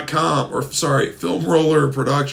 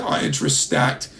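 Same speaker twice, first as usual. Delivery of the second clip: ordinary talk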